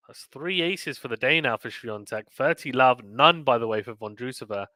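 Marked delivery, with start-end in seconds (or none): none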